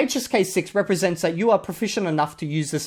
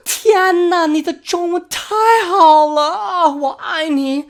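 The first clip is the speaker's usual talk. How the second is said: high, loud, slow gushing delight; overwhelmed with joy at a foreigner's Chinese; mock ecstatic fan voice; gushing praise; 12 semitones higher, 5 dB louder, slower than usual. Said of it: "they'll be like".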